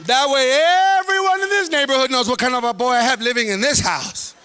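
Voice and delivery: loud slow defiant mom; mom yelling. Retold or imitated. Mom to son in front of neighbors, loud defiance. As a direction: shout it out for the whole neighborhood.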